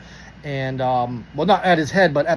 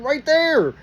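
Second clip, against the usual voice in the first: high-pitched, obvious-answer retort; exasperated reply; 10 semitones higher than usual. Retold or imitated. Driver shows cop where he lives, exasperated. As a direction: act out stating the obvious, voice shooting up. Acted answer, pointing out home.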